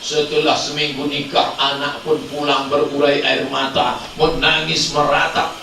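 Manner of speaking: normal talking voice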